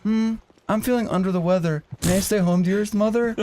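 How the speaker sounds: a lightly hammed-up, overly polite son; mock-polite sick boy. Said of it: "I was like".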